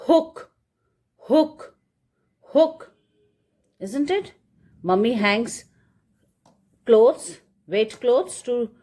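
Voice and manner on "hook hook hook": hook, repeated clearly and a bit louder; drilled word hook. Shown as a demonstration. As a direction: say hook firmly for the child. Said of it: "this part is called".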